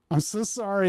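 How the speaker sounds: a rich man's insincere sorry; sarcastic sorry about being rich; mock apology. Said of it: "I'm just so rich".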